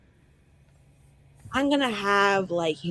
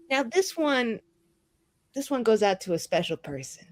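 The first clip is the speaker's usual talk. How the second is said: ordinary talk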